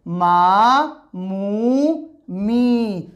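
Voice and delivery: मा, मू, मी each with a long vowel; long vowel demo. Shown as a demonstration. Teacher reciting the three long vowels for students. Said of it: "तो word बन गया".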